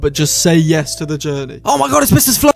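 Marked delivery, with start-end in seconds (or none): 1.6-2.5 high, loud delighted squeal; excited. Acted. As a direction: gasp with delight. Excited gasp at a character.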